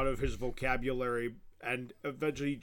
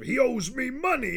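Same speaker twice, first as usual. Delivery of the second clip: Mr. Krabs-like voice; 11 semitones higher, 5 dB louder than usual. Retold or imitated. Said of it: "it sounds like Mister Krabs".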